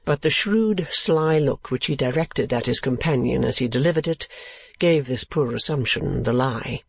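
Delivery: plain reading voice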